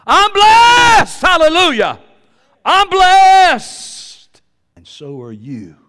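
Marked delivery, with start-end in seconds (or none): 0.1-2.0 high, very loud preacher's shout; exultant shout. Real, not acted. Preacher declaring his blessing to the church, exultant shout. 2.7-4.4 high, very loud preacher's shout; exultant shout. Real, not acted. Preacher declaring his blessing to the church, exultant shout.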